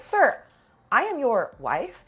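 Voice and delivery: high, loud opening, then slowed right down; indignant confrontation. Retold or imitated. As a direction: confront him in mock outrage, drawing out the words. Acted confrontation with her husband.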